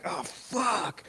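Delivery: high, startled curse; sudden panic. Retold or imitated. Realizing the train goes the wrong way: high panicked curse. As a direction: catch the mistake in a flash of panic. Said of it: "It's like".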